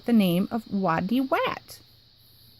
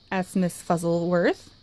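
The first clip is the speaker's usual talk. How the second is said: plain reading voice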